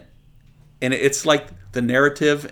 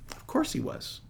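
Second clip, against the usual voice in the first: whispered aside; 13 semitones higher, 12 dB quieter than usual. Real, not acted. Aside after explaining scripture.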